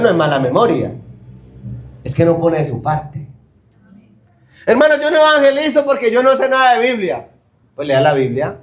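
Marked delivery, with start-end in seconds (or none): 4.7-7.3 high whiny excuse voice; mock excuse. Preacher mocking believers' excuses, higher whiny voice. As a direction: mimic the excuse with a higher pitch. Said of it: "hay gente que pone unas excusas".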